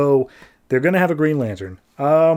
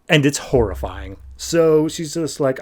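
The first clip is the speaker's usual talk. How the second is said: normal talking voice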